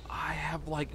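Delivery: whispered under breath. Real, not acted. Player searching alone, whispering to himself. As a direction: whisper it to yourself.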